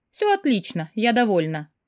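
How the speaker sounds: ordinary delivery, nothing marked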